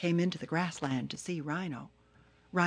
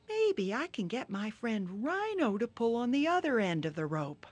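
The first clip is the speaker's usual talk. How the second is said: lightly high, rueful turtle; Turtle thinking aloud; 6 semitones higher than usual. Acted. Turtle alone in the jungle, a hint of regret. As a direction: muse to yourself, a bit high.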